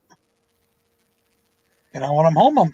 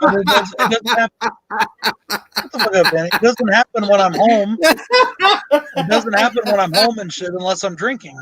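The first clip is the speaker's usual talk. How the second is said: laughing; 4 semitones higher than usual. Real, not acted. Laughing through the story.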